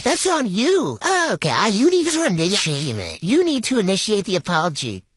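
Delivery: normal talking voice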